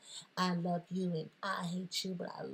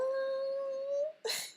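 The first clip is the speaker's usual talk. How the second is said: shrieking laugh. Acted. Over-the-top reaction to own joke.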